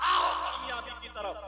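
imitated prayer caller. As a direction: call people over, as a muezzin would. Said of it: "Mohsin पांच वक्त में कहता".

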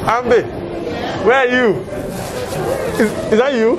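calling out. Real, not acted. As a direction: call out to find your friend.

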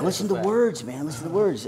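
mock a buddy urging him to listen closely; imitating Max. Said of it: "but he's like".

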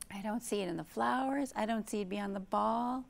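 lively storybook reading; reading to children. Acted. Teacher reading a picture book aloud, lively. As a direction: read it to a circle of little kids.

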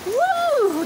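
high, loud whoop; excited woo. Real, not acted. Cheering the storm at night, a high loud whoop. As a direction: let out a thrilled whoop at the thunder.

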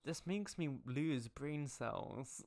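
slow, drawn-out lament; exasperated. Real, not acted. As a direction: drag it out in despair. Groaning at the writing.

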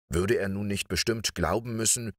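ordinary delivery

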